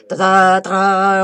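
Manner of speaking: sung-out ta-da drum roll; playful mouth fanfare before the reveal; vocal fanfare. Pretend. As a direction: announce it like a fanfare, ta-da. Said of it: "roulement de tambour".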